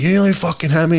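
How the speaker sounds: mock road rage shout. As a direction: yell it at the other driver through the window. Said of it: "I'm like".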